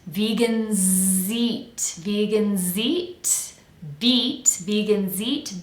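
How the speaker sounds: "vegans eat" very slow, z flowing into eat; linked words. Shown as a demonstration. Coach connects vegans to eat, slow linked demo. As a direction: draw out "vegans eat", joining z to the vowel. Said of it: "Let's connect that to eat".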